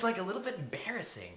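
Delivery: normal talking voice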